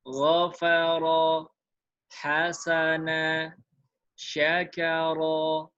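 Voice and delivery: very slow, every syllable held equally long; slow model reading. Shown as a demonstration. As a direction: read it slowly so students copy the beat. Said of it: "Latihannya saya bacakan". (dibaca sangat pelan, tiap suku sama panjang)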